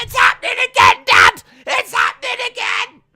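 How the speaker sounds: very high, loud panicked shriek; hammed-up screaming son calling for help; Dominic. Dominic crying to his dad, hammed-up panicked shriek. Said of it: "Dominic's like".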